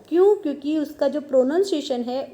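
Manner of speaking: usual speaking voice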